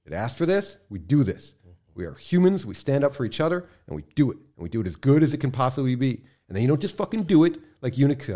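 real frustration boiling over into a rant; impassioned, angry preaching tone; getting upset. Real, not acted. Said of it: "I'm getting upset".

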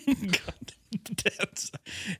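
slow, drawn-out dramatic naming; slow dramatic echo. Pretend. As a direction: savor each word slowly. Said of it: "echoed slowly".

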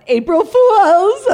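high, loud, slow laughing shout; spring the prank reveal with a laugh; gleeful shout. Real, not acted. Revealing the haircut joke.

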